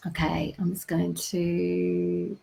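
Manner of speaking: slow whispered aside; hushed slow aside. Real, not acted. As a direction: whisper it under your breath.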